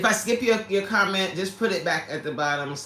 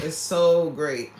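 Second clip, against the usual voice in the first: normal talking voice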